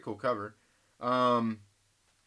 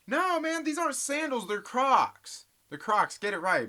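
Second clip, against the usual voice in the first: much higher, mock-offended squeal; indignant correction; 9 semitones higher than usual. Insists to imagined critic, Crocs.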